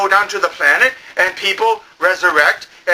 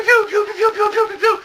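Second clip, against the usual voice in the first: high pew laser noises. Retold or imitated. Everyone shooting, pewing high. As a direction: fire off high pews. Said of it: "it's all pew".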